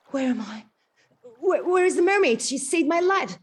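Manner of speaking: rising excited call; confused prince. Acted. Eric waking on the beach, asking for the mermaid, urgent.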